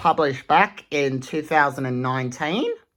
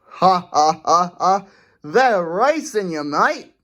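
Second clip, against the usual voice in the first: louder hearty mock laugh; Shane laughing; 3 semitones higher, 4 dB louder than usual. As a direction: laugh along at the kangaroos. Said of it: "Shane laughed".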